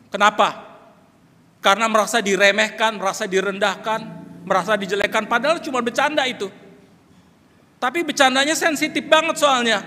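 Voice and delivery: berseru jauh lebih tinggi dan keras; seruan berkhotbah. Real, not acted. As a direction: dorong jemaat dengan suara lantang. Khotbah memuncak berseru. (pendeta berseru lantang pada jemaat)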